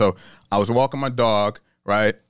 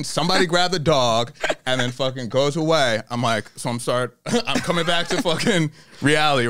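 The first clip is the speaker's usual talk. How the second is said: ordinary talk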